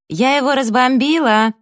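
high, loud triumphant call; yelling across the room. A narrator's reading. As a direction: shout it across the room, gloating. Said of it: "крикнула через всю комнату Эбби".

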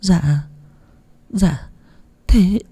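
cowed woman stammering back at the scolding fortune teller. A narrator's reading. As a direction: falter timidly over each word. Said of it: "ngồi ấp a ấp úng".

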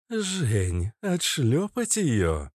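slow, drawn-out growl; growling cobra. Acted. Shapeshifter cobra offers to spank the girl, growling. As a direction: growl it out, stretching the words. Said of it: "прорычала кобра".

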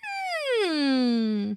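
long, high hmm sliding down; drawn-out thoughtful hmm; pondering hum. A narrator's reading. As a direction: hum doubtfully while weighing it up. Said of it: "cocking her head one way".

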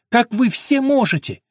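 high, loud, slow cry; anguished cry. Acted. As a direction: cry out in despair. Eleanor's anguished outcry.

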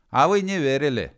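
high, loud taunt; little man's shout. A narrator's reading. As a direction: shout a triumphant ultimatum. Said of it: "крикнул человечек".

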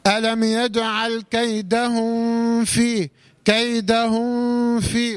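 meem of كيدهم blurred into a long nasal hum before في; slow recitation, hiding the meem in كيدهم في; mistaken hidden meem. Shown as a demonstration. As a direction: draw out a hum on the end of كيدهم, sliding into في. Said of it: "وهذا خطأ شائع".

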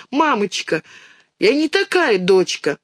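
lightly voiced plaintive child. A narrator's reading. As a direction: plead with mama for forgiveness. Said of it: "жалобно говорит Динка".

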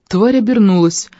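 plain reading voice

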